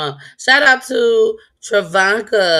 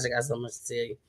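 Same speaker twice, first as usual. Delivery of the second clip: fading into a near whisper; hushed aside; 6 semitones lower, 6 dB quieter, faster than usual. Real, not acted. Host promising Carmen a later talk, hushed. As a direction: drop away until barely audible.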